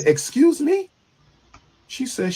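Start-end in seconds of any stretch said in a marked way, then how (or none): 0.0-0.9 high, stunned excuse me; shocked. Retold or imitated. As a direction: disbelief on excuse me. Shocked reply to news.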